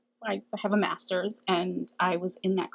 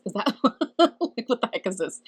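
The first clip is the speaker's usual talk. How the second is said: incredulous laughing question; 4 semitones higher, 6 dB louder than usual. Retold or imitated. Retelling shock at hawk omen.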